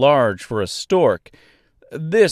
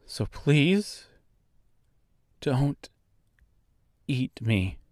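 soft, halting, with long pauses; careful calm plea; 3 semitones higher, 7 dB quieter, much slower than usual. A narrator's reading. Dorothy to the oversized bird, pleading calmly and softly. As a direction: plead quietly, word by word. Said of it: "she said calmly".

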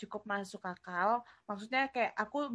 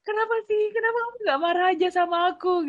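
mock plea; 9 semitones higher, 7 dB louder, faster than usual. Retelling her pleading reaction to brother.

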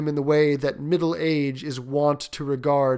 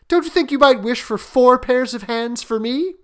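high, louder teasing wife; 10 semitones higher, 7 dB louder than usual. Acted. Wife joking over supper about extra hands, lightly teasing. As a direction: raise the pitch for a joking request.

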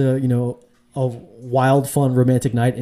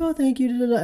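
much higher, sing-song thanks; a light put-on woman's voice, higher; mock grateful woman; 12 semitones higher than usual. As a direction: pitch way up to play her thanking him. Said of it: "She was like".

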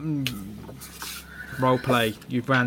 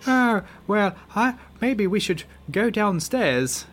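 higher-pitched, British-sounding attempt at an American woman; badly faked American accent, sliding into British, pitched up; female American accent attempt; 9 semitones higher than usual. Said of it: "that's more British than American".

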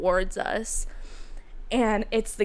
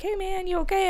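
mock call to check he's okay; tentative, high-pitched "you okay?" call; checking on someone; 11 semitones higher than usual. Calling out to crash victim.

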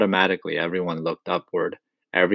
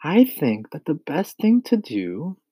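put-on higher boy's voice, working it out; 7 semitones higher than usual. A narrator's reading. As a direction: think aloud in a higher voice, left hanging.

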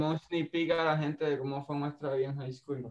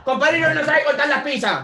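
high, loud hammed-up complaint; yelling the pizza gripe in mock fury; mock outraged shout; 11 semitones higher, 11 dB louder than usual. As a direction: belt it out like a fake outrage.

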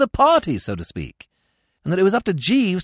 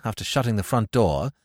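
ordinary delivery, nothing marked

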